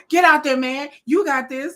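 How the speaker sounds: high, loud coach-style hype; pep talk. Acted. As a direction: fire someone up before they go out. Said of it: "they pep talking you".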